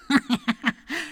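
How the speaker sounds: wolf's sly snicker; sneering snicker. Acted. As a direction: snicker meanly. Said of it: "ricana le loup". (ricanement du loup)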